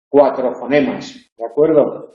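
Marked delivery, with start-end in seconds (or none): none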